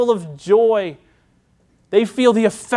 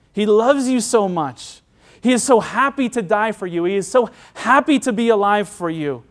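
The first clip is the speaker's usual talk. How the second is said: usual speaking voice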